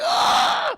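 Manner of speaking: loud, piercing acted scream of pain; high agonized scream. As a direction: scream your head off in mock agony. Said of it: "he's just screaming his fucking head out".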